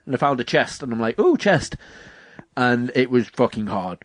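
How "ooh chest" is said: high-pitched delighted "ooh"; mock-excited self-quote, pitched up; excited discovery. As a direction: pipe up high at spotting treasure. Retells spotting a treasure chest.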